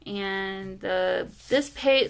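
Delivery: usual speaking voice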